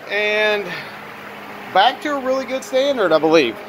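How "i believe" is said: ordinary delivery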